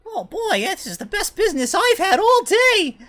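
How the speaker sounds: put-on very high, loud and laughing kid voice; fake little boy voice. Gnome in disguise greets the customers in a high, laughing boy voice. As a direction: squeak it out like an excited child, cracking up. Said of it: "he kinda looks at you and goes".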